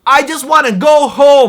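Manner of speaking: yelled high and loud; yelling. Retold or imitated. Vlogger retelling his yell at the taxi gang, loud. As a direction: shout it out in despair. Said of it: "I stood up and yelled".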